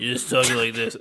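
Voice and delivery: lightly imitated character voice; put-on actor voice. Co-host mocks the actor's supposed special voice, lightly imitating. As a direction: put on a slightly altered talking voice. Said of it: "You have to scream to talk like that?".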